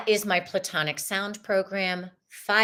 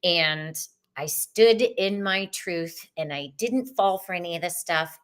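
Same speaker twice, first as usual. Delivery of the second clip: usual speaking voice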